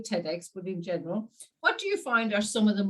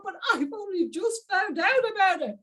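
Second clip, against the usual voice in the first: much higher, gushing; excited protest; 11 semitones higher than usual. Retold or imitated. Answering friends who tease her, much higher and excited. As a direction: defend yourself with bubbling enthusiasm. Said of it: "accuse me sometimes of being so excited".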